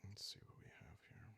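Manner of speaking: a quick whisper under the breath; whispered to self. Real, not acted. Player reading the game page to himself, quick whisper. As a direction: murmur it to yourself while looking something up.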